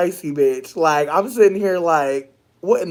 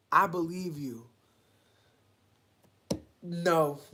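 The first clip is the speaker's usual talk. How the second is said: usual speaking voice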